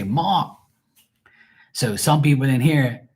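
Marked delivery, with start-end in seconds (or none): 0.0-0.6 "Mark" without the R, like "Mahk", pitched high; local pronunciation. Shown as a demonstration. Showing how locals say the name, "Mark" as R-less "Mahk". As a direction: drop the R in "Mark" and lift it. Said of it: "They say".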